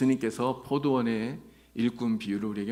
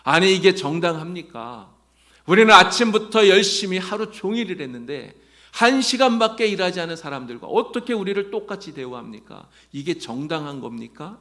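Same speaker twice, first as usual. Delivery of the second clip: loud, indignant demand, pitched higher; angry protest; 5 semitones higher, 9 dB louder than usual. Retold or imitated. Workers confronting the vineyard owner over equal pay, loud indignant protest. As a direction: throw the unfairness back at the owner. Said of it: "기분이 확 상해서 주인에게 따져요".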